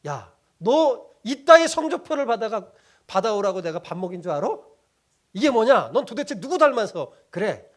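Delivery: a mother blowing up over a bad report card; mock mother's rant at her child; furious mom. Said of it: "노발대발하죠".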